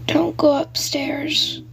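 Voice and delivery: slow, whispered warning down the phone; ghostly whisper. Acted. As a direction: warn them in a slow hush, like a ghost.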